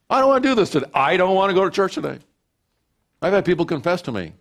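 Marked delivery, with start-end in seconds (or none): 0.1-2.2 high, whiny mock complaint; whiny lazy voice. Pastor mimicking a lazy believer, high whiny complaint. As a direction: whine like a reluctant churchgoer.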